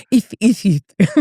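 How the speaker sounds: laughing imitation of lisped Spanish-accented German; imitated Spanish-accented German. Friend recalling classmates in German class, mock lisping Spanish accent. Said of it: "they had a little lift in there".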